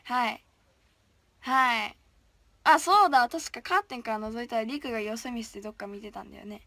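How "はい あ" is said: ordinary delivery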